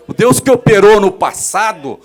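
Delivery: loud proclaiming preacher; loud fervent preaching; preaching at full force. Acted. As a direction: thunder it out to the congregation.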